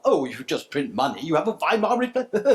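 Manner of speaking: mock the critics who dismiss printing money. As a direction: sneer it back like a know-it-all opponent. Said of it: "our critics ... will use that as an example".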